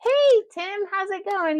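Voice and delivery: bright high host greeting. Acted. Greeting a figurine voiced high.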